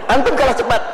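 teasing with a laugh; laughing jab at the lovelorn listener; laughing tease. Real, not acted. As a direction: chuckle through the words.

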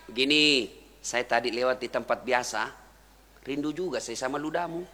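soft, low, tender teasing; gentle visitor's voice. Retold or imitated. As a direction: confide it quietly and kindly. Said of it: "lalu berkata apa Nabi?".

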